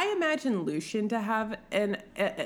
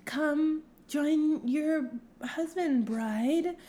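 priestess summoning bride; 6 semitones higher, much slower than usual. Acted. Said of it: "And Eonthe's like".

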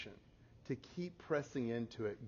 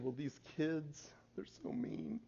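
mock tearful; 2 semitones higher, 7 dB quieter than usual. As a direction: imitate a daughter crying down the phone. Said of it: "she just started crying".

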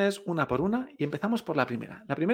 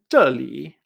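"Zheli" punched down first, curving at the end; fall, then curved tone; 3 semitones lower, 5 dB louder than usual. Shown as a demonstration. Teacher repeating here, "Zheli" falling then curved. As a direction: strike "Zhe", scoop through "li". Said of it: "este tono curvo".

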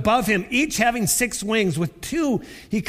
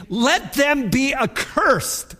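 fierce curse; 7 semitones higher, slower than usual. Acted. Paul warning Galatians, slow shouted curse. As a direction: thunder the curse slowly.

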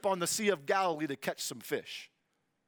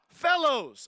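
raised, higher call to people far off; 6 semitones higher, 6 dB louder than usual. Acted. Jesus calls out to the disciples from the beach, calling out. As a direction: call out across the water. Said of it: "So he called out".

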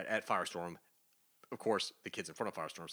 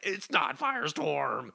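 ominous warning; 8 semitones higher, 8 dB louder, slower than usual. Retold or imitated. Batman warns ominously.

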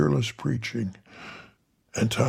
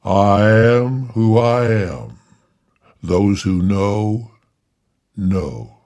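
loud, slow, grand voice from inside; booming angel voice; 5 dB louder, much slower than usual. Acted. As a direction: boom it like a heavenly being. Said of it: "said the voice".

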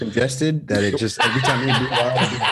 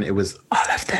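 whisper; 2 semitones lower than usual. Real, not acted. Joking aside about other women.

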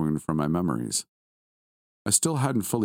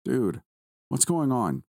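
alarmed; 7 semitones higher, slower than usual. A narrator's reading. Friend alarmed on the phone.

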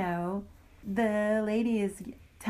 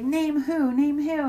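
challenging demand; 6 semitones higher, 6 dB louder, slower than usual. Retold or imitated. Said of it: "And then she's like".

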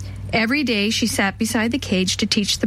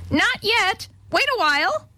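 very high parrot squawk; parrot shriek; 11 semitones higher than usual. A narrator's reading. Parrot mimics Alice to the old woman, shrieking.

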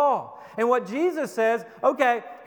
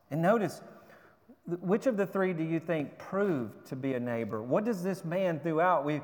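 ordinary talk, nothing marked

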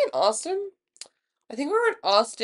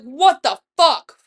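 slow, louder disbelief; retold outrage; 2 semitones higher, 7 dB louder, slower than usual. Confronting her friend on the couch, acted outrage. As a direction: stretch it out in indignant re-enactment.

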